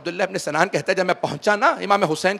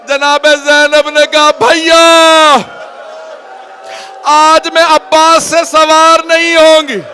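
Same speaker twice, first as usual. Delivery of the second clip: lamenting preacher; 7 semitones higher, 10 dB louder than usual. Acted. Preacher lamenting the mounting of the women to the weeping congregation, loud wailing recitation. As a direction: pour grief over the mourners at full force.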